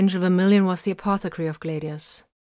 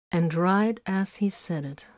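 ordinary delivery, nothing marked

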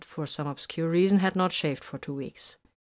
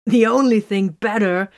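louder, grinning tease; provocative grin; 4 semitones higher, 9 dB louder than usual. A narrator's reading. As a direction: needle them with a grin. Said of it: "grinning provocatively".

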